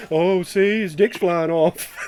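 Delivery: deadpan counsellor voice pointing it out; cartoon school counsellor impression; Mister Mackey. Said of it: "a good Mister Mackey impression".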